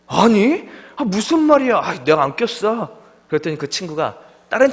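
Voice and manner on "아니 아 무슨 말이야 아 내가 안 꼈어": high, mock-flustered denial that slows at the end; higher, hammed-up panicked "I didn't do it". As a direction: play the caught culprit denying it, embarrassed. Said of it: "저는 당황하고 부끄러워서".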